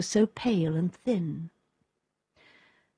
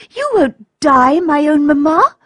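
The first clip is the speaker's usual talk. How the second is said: cried out; 8 semitones higher, 7 dB louder than usual. A narrator's reading. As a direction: cry it out to reassure her mother. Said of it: "cried Olga".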